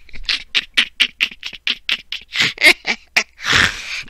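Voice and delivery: high, breathless laughing fit; helpless laughter. Real, not acted. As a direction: laugh till the air runs out. Cracking up at own joke.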